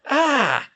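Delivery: high loud squeal of surprise; a girl yelps when lifted off her feet; startled shriek. A narrator's reading. Hermione shrieks when the twins lift her.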